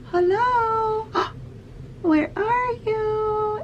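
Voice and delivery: high squeaky puppet voice, very slow on the question. Shown as a demonstration. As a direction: play a shy-coaxing puppet for a child. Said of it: "have a puppet, and I'm like".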